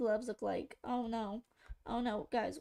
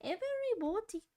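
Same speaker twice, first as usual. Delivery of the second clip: posh British woman; 8 semitones higher than usual. Retold or imitated. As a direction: stretch it out like a prim British lady. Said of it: "Who am I copying now?".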